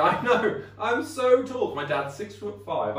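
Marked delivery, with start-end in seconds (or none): 0.0-1.7 high, louder playful brag; bright mock boast about height; cheerful self-joke. Shown as a demonstration. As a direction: laugh along at yourself. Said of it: "And I go".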